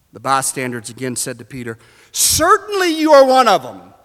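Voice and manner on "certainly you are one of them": high, loud, insistent accusation; intense accusation. Acted. Bystanders accusing Peter once more, intense and insistent. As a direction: accuse him with full certainty. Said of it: "accusations increases in intensity".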